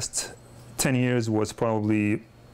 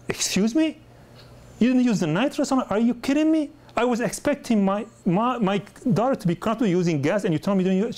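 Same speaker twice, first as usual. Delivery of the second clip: angry mom flipping out; 11 semitones higher than usual. Retold or imitated. Dentist retelling a mother's outburst over skipped nitrous, high outraged yell. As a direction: imitate an indignant mother blowing up at you. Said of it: "she flipped out on me".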